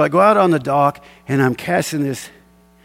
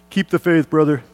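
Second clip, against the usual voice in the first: smug parting line; 2 semitones higher than usual. Retold or imitated. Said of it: "I just walked by him, and I said".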